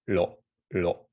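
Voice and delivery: "lo, lo" slowly with the tongue tip flicked lightly. Shown as a demonstration. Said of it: "like that".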